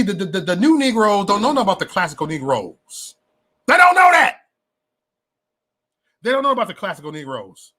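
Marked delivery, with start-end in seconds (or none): 3.7-4.4 high-pitched, loud outburst; sudden loud exclamation; emphatic shout. Real, not acted. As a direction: shout it out much higher. Exclaims about the new generation.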